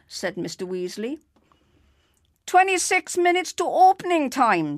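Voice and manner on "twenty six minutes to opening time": put-on high, loud call of an old man; old man in a Panama hat shouting the countdown. A narrator's reading. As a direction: call out the time to the whole bus. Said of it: "shouted an old man".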